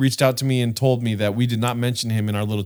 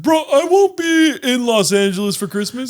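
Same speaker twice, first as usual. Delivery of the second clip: high, louder mock Melvin; imitating Melvin; 9 semitones higher, 4 dB louder than usual. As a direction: toss off his friend's line. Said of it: "'cause he said".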